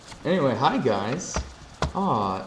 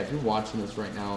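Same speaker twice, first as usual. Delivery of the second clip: usual speaking voice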